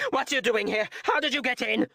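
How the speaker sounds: frightened woman shrieks at a stranger in her room; panicked shriek. A narrator's reading. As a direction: climb into a shrill panic. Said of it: "the woman shrieked into the man's ear".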